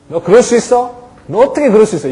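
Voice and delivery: high, loud accusing demand; accusing you-message. Shown as a demonstration. Teacher scolding a child, loud and accusing. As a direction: confront the child with blame. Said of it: "이건 네오 메시지라고 하죠".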